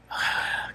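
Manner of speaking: long breathy sigh of dread; acted sigh. Man dreading the walk to his office, acted sigh. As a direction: let out a weary, imitated sigh.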